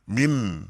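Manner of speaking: MIN with a crisp, audible final n; clear nun. Shown as a demonstration. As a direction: demonstrate MIN, n clearly sounded without humming. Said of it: "nunnya jelas".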